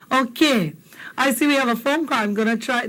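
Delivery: normal talking voice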